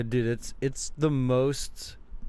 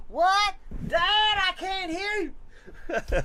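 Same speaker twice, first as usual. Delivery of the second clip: very high, slow cartoon-kid shout; Bobby Hill; much slower than usual. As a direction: yell back like a confused cartoon boy. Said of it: "my Bobby Hill".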